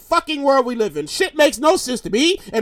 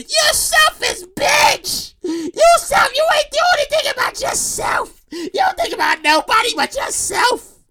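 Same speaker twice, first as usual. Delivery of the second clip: high, hammed-up indignant scolding; outraged family; 8 semitones higher than usual. Family berating her for returning cash, outraged. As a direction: wail the accusation, stretching the key words.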